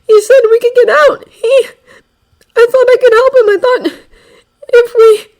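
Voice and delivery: high, loud, tearful pleading; breaking down. Acted. Izuku telling the villain about the betrayal, tearful. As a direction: crack under the betrayal.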